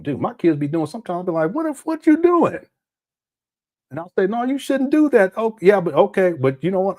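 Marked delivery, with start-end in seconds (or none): 1.5-2.6 high, startled what-are-you-doing; mock alarmed parent catching the kids; much higher disbelieving parent. 4.3-5.3 high, warning parent voice; higher, mock scolding of the kids.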